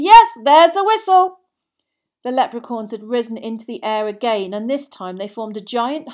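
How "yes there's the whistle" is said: high, very loud announcer's shout; excited commentator. Acted. Commentator calls the whistle to the crowd, excited shout. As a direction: call it out slowly to a packed stadium.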